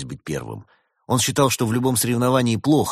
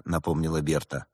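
usual speaking voice

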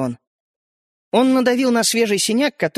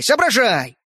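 louder, pushier соображай; impatient urging; 3 semitones higher, 4 dB louder, slower than usual. A narrator's reading. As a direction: press him harder, a bit louder. Toy man urging louder.

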